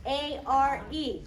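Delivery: slow spelling. Shown as a demonstration. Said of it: "Everyone say".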